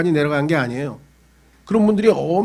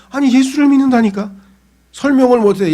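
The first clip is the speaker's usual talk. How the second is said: insistent; 7 semitones higher, 4 dB louder than usual. Retold or imitated. Believer insisting he believes.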